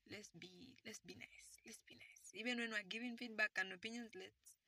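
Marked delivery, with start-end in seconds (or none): 0.1-2.3 gentle whisper, barely voiced; hushed please-be-nice whisper. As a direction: whisper it like a soft request. Soft plea to listeners.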